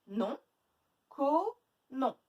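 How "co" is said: CO held long, pitched higher; stretched syllable. Shown as a demonstration. Teacher checking the last syllable for A, stretched. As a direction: stretch Co into cooo. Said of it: "Où est-ce qu'on entend le A?".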